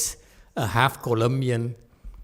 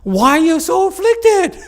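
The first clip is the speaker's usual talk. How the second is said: self-questioning; 6 dB louder than usual. Retold or imitated. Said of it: "I asked myself".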